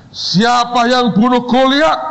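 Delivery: high, slow theatrical demand; acted interrogation. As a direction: draw the question out accusingly. Boss interrogates second student.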